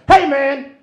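loud, high mock snap; hammed-up angry outburst; snapping at kid. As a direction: lose it on a five-year-old, comically loud. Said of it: "I snapped one day".

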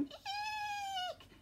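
high little eek shriek; mom's shriek. Acted. Mom sees son on hose, high squeak. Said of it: "his mom's going".

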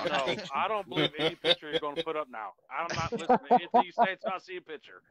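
talking through laughter; loud, laughing disbelief. Real, not acted. Laughing at friend's pictures.

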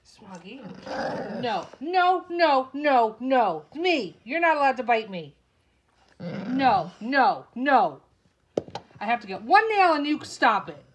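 loud, forceful no to a biting pet; real angry reprimand; stern scolding. Real, not acted. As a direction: shout firm commands, exasperated.

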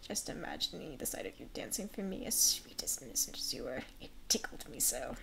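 low, husky whispering master; slow, condescending master with veiled menace; menacing master. Acted. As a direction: purr a threat to a servant in a hushed, breathy voice. Plays Primrose's controlling master.

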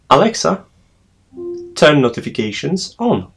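projected smart-speaker command. Real, not acted. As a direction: call out clearly to a voice assistant.

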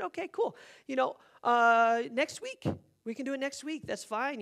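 high-pitched, falsely casual agreement; awkward stalling. Retold or imitated. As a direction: put them off with a too-breezy yes. Stalling a stranger's request.

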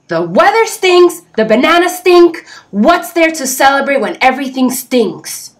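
loud, high cranky complaint; snapping grump. A narrator's reading. Grumpy Jim snaps at friends about the weather. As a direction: snap it out in disgust. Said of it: "snapped Jim".

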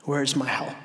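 low, soft plea; searching question. Acted. Preacher voicing those in tragedy, low hushed plea. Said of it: "In moments of tragedy".

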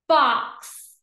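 BOX joined in one go; blended word. Shown as a demonstration. Teacher blending for pupils, BOX as one word. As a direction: say BOX whole and firmly. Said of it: "read each word fast".

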